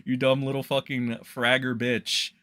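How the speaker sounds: normal talking voice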